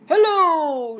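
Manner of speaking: tortoise greeting Hank with a shout. Acted. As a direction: call out a big hello. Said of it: "shouted Tortoise".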